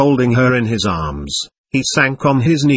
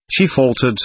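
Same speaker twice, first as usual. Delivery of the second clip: usual speaking voice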